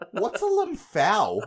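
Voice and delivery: slow, drawn-out mock puzzled ask; mock naive question. A clerk being baited with a slow, innocent, puzzled question. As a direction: play dumb to annoy. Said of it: "just to piss her off".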